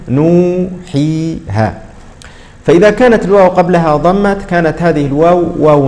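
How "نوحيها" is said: نوحيها said very slowly, long vowels drawn out; mnemonic word. Shown as a demonstration. Teacher draws out the memory word for students. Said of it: "في قولهم".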